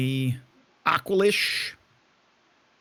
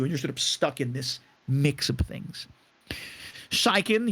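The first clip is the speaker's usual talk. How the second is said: ordinary talk, nothing marked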